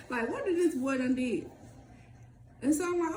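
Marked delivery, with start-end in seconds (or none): none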